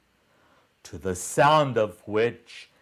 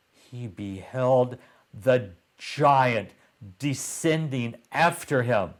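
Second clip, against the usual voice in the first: ordinary delivery, nothing marked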